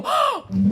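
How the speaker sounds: loud, high mock gasp; theatrical intake of breath; shocked gasp. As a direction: gasp in exaggerated offense. Acts out shock at the insult.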